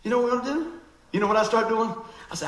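usual speaking voice

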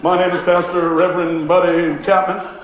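lightly mocking a formal pastor introducing himself; a hint of puffed-up reverend; self-important introduction. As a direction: announce your full title with mock pomp. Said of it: "I didn't go".

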